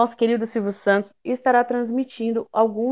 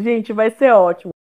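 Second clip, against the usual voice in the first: ordinary delivery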